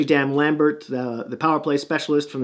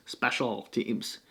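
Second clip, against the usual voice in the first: SPECIAL TEAMS slow and much softer; careful self-correction; 4 semitones lower, 11 dB quieter, slower than usual. Real, not acted. Host fixing his slip of the tongue, slow quiet "Special teams". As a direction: say "Special teams" slowly, low and under the voice.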